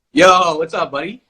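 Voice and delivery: high, loud laid-back bro voice; casual bro greeting. Retold or imitated. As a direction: greet them like a chill buddy. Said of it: "keeping it sound like".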